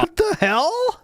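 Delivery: real bafflement at the clip; baffled. Real, not acted. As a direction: react like the words made no sense at all.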